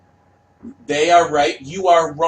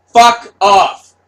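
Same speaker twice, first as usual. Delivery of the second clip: higher, much louder yell; furious shout; 7 semitones higher, 10 dB louder than usual. Retold or imitated. Artist screaming at fans to go away, furious shout. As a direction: scream it in their face.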